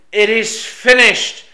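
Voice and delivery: slow, loud, high and triumphant; ring out triumphantly. Acted. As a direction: let it peal out like a victory bell. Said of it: "ring out triumphantly".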